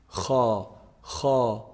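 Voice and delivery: kha with raspy friction at the top of the throat; kha, kha, heavy and scraping; Arabic kha from upper throat. Shown as a demonstration. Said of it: "pronounced from the top part of the throat".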